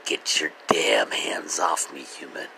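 raspy whispered cat threat; voicing the cat. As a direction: hiss it like an annoyed cat. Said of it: "she's like".

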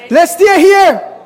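high, loud, slow call; loud proclamation. Acted. Shepherds' quote read aloud.

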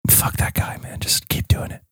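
very soft whisper; hushed, breath-only aside; ASMR whisper. Pretend. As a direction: whisper urgently to keep the mood. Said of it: "It's A S M R".

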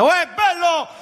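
high, far-off hammed-up Neapolitan shouts rising each time; mock Neapolitan yelling. Neapolitan caller greets him at half past eight, high mock Neapolitan yelling. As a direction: holler a greeting down the phone, Naples style. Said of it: "E cazzo urli".

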